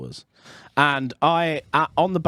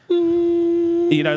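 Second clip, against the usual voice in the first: drawn-out frozen pause; 4 dB louder than usual. Real, not acted. Frozen moment.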